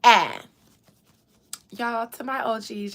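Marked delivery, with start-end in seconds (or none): 0.0-0.4 sharp loud ah cry; ah shout. Shown as a demonstration. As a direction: belt a short ah. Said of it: "perfect that ah".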